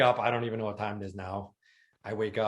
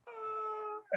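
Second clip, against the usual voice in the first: pained ah; 9 dB quieter than usual. Retold or imitated. CT scan pain on shoulders.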